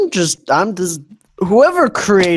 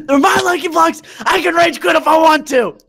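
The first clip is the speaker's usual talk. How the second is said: high whiny bratty voice; childish whine about lucky blocks; mock tantrum; 11 semitones higher than usual. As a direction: throw a pretend fit. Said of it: "Who's gonna stop me".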